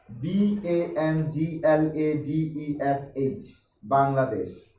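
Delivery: slow, separate letters B-A-N-G-L-A-D-E-S-H, then the whole word Bangladesh; letter-by-letter spelling. Shown as a demonstration. Teacher shows how many pupils spell Bangladesh, letter by letter. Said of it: "এইভাবে অনেকে পড়ে".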